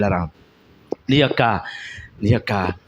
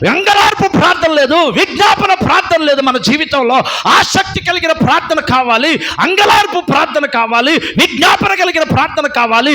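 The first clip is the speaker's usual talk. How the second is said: higher, louder preaching at full force; fiery preaching; 11 semitones higher, 6 dB louder than usual. Real, not acted. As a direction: exhort the congregation with fire.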